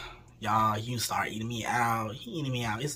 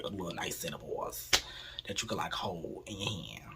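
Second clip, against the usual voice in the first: whispered aside; 6 semitones lower, 10 dB quieter than usual. Real, not acted. Intimate detail, whispered.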